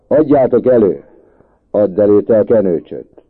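loud, high shouted order; heated schoolboy quarrel; angry demand. A narrator's reading. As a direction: bellow the demand back and forth. Angry boys demand back the things.

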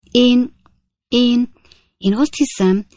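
ordinary delivery, nothing marked

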